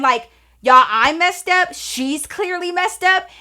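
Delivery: ordinary delivery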